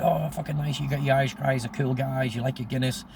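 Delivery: gushing Irish-fan American. Retold or imitated. American reacts to hearing he grew up in Ireland, imitated gushing.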